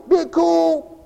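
high, loud put-on "be cool"; mock cool person. Preacher imitating what they tell him, high and loud. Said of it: "Or they'll tell me".

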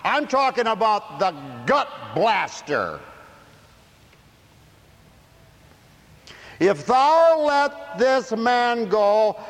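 high, loud, slow and heavy; slow shouted preaching. Acted. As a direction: drag out each word from the pulpit. Preaching at full force.

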